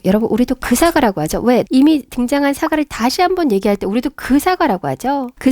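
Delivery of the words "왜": ordinary talk, nothing marked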